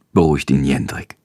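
ordinary delivery

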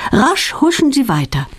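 ordinary delivery

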